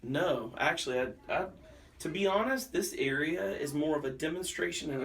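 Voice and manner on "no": ordinary delivery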